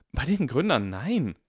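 hammed-up protest; emphatic denial. A narrator's reading. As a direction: blurt out the refusal.

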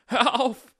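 high, loud pleading scream; desperate scream. Acted. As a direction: scream for it to stop. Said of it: "schrie Harry". (verzweifelt geschrien)

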